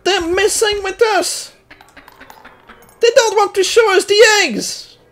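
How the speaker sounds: high-pitched frustrated ranting at the game; angry rant. Real, not acted. Player ranting at the game for withholding eggs, angry and high. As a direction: yell your grievance at the game, pitch up. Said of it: "I'm so pissed off right now!".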